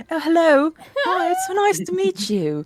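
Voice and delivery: high, posh British greeting, slow on the second line; mock British accent. Streamer putting on a British accent for the intro, high and slow. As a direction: put on a sweet British accent to say hello. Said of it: "I think I'll be British".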